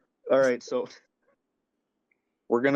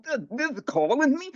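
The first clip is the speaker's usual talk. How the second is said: squeaky high bewildered voice; imitating Lat Molly; 13 semitones higher than usual. Buddy mocking Lat Molly's surprise, squeaky high voice. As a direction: play him baffled and squeaking. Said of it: "Lat Molly would be like".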